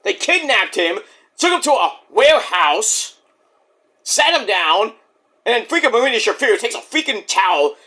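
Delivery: ordinary talk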